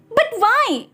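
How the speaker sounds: high, protesting whine; angry boy. A narrator's reading. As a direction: push back hard at dad. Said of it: "Arun गुस्से से बोला". (ऊँची आवाज़ में गुस्से भरा विरोध)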